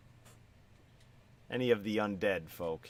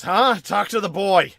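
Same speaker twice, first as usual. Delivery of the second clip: loud, high shout over the hammering; yelling smith; 10 semitones higher, 11 dB louder than usual. Acted. Blacksmith yelling at the customer to go ask the boy. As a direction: bark it out, annoyed and busy. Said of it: "He yells".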